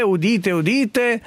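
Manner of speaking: town crier. Acted. Announcing the news with a crier's call.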